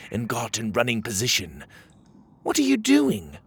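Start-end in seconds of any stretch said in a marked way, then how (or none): 2.4-3.4 high, curious little Pockets voice; Pockets asking. Acted. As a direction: pitch way up for a small creature's question. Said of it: "Pockets asked him".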